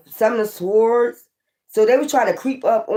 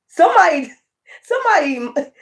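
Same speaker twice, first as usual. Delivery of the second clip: laughing; 7 semitones higher than usual. Real, not acted. Laughing through tarot reading.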